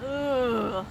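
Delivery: plain reading voice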